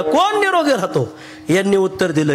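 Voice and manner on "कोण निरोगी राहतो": bird asking. Acted. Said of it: "परत दुसऱ्यांदा विचारलं".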